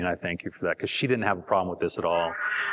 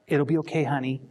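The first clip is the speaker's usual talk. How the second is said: higher-pitched, condescending reassurance; mock-comforting, pitched up and patronizing; 4 semitones higher than usual. Shown as a demonstration. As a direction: soothe them as if talking down to them. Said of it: "without being patronizing".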